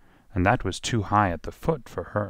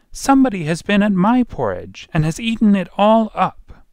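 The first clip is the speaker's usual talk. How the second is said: very high, loud tiny bear; small wee voice; 12 semitones higher, 7 dB louder than usual. A narrator's reading. Wee bear finds his porridge eaten, high wee voice. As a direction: squeal it out as a little wee bear. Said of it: "wee voice".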